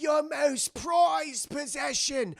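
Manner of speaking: put-on high, slow old rat voice; drawn-out doddery pirate; ancient cabin boy. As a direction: present a treasure reverently. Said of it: "He's like".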